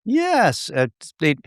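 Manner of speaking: ordinary talk, nothing marked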